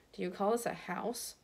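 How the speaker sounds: quiet, understated sneer. A narrator's reading. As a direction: look down on the place. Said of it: "sneering as he looked around".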